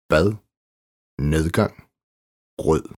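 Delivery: bad, nedgang, rød with soft D, almost English th; tongue behind the teeth for the D in "bad", "nedgang", "rød". Shown as a demonstration. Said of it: "blødt D". (blødt D i bad, nedgang og rød)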